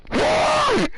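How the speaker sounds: very high, loud squealed why; exasperated shriek. Retold or imitated. Vlogger retelling his reply to Lester, loud high squeal. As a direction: shriek it at the top of your range. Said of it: "I'm like".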